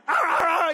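high, loud growling barks; dog barking. Retold or imitated. Dog barking again for its food, high and loud. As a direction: bark like a hungry dog. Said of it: "he's like".

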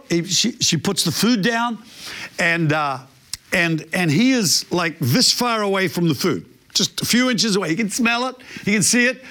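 ordinary delivery

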